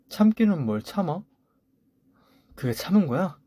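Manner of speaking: high, sharp, scornful comeback; angry retort. Acted. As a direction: throw his words back at him. Wife fights back at husband.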